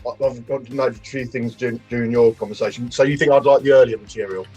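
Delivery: ordinary talk, nothing marked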